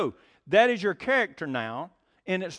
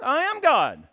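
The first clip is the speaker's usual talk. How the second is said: mock proclamation; 7 semitones higher, 6 dB louder, slower than usual. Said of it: "what Shirley MacLaine said".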